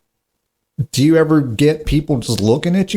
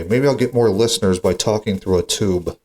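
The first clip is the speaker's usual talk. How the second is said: normal talking voice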